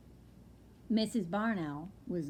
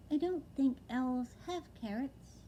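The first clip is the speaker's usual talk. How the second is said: high, small owlet voice; a young owl, wide-eyed and dazed; little owlet voice; 5 semitones higher than usual. Acted. Owlet telling his father, high and small. Plop's voice.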